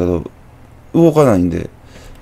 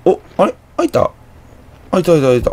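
surprised; 9 semitones higher than usual. Real, not acted. Door unexpectedly opens in game.

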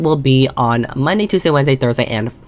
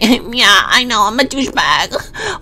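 put-on cocky teen voice; 5 semitones higher, slower than usual. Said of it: "he's like".

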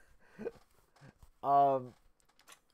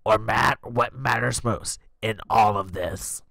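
grandma voice; 4 semitones lower, 5 dB louder, slower than usual. Acted. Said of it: "Grandma finishes".

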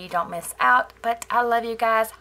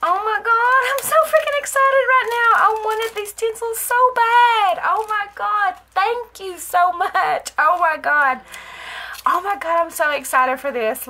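high, loud, gushing excitement; overexcited; 10 semitones higher, 8 dB louder than usual. Real, not acted. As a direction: squeal it out, overcome with real excitement. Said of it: "I got a little overexcited".

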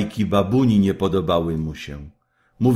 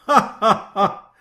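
mocking laugh; 13 semitones higher, 5 dB louder than usual. A narrator's reading. Kaj laughs at Gerda.

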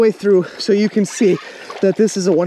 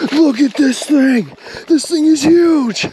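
high, slow, excited exclaiming; thrilled; 8 semitones higher, 3 dB louder, slower than usual. Real, not acted. Angler shows viewers the huge catch, thrilled. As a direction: stretch each phrase in real amazement. Just landed a huge fish.

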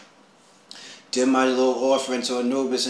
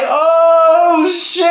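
startled; 11 dB louder than usual. Retold or imitated. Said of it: "I'm like".

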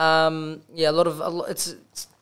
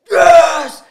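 loud, high shout of joy; yell; 8 semitones higher, 8 dB louder than usual. Retold or imitated. Celebrating the winning goal in a car park, loud yell. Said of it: "I yelled".

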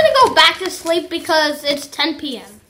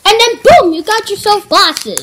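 excited reveal; 4 semitones higher, 5 dB louder than usual. Real, not acted. Big reveal of the glasses.

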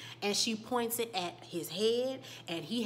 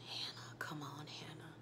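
whispered urging; 4 semitones lower, 12 dB quieter than usual. Retold or imitated. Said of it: "he's saying".

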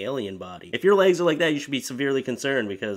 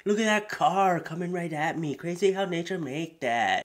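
higher put-on voice; mock naive wonder; awed dimwit; 5 semitones higher than usual. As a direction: marvel at everything like a fool. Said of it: "He's like".

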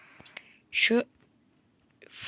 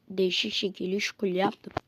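ordinary talk, nothing marked

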